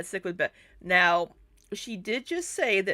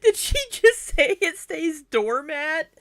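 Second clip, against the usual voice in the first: high, loud question through laughter; incredulous laughing; 10 semitones higher, 6 dB louder than usual. Real, not acted. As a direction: crack up in disbelief while asking. Cracking up at a misspoken word.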